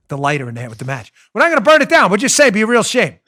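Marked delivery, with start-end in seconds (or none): 1.3-3.2 loud, higher wiseguy threat dressed as reassurance; mock mob enforcer. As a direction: lean on him like a mobster who swears he means no harm. Mob enforcer with lighter in hand.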